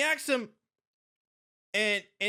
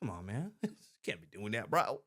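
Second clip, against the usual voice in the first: much softer, amused chuckle; laughing quietly; 8 dB quieter than usual. Real, not acted. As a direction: chuckle it under your breath. Reacting to clip.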